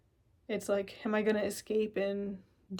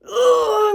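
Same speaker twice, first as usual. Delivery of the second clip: high, loud anguished ugh; frustrated groan; 13 semitones higher, 14 dB louder than usual. Acted. Stuck on the highway, groaning at everything going wrong. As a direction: let out a cry of frustration. Acted frustration, heard cry.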